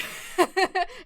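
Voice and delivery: high, loud peal of laughter. Real, not acted. Streamer amused by the art cycle, loud laugh. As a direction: burst out laughing.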